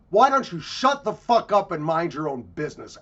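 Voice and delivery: loud, high mock yell of a rude tourist; heated, shouted imitation of an insult; angry retort. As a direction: blast it out as an angry quoted comeback. Quotes a hostile tourist's reply.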